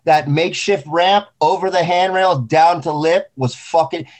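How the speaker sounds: normal talking voice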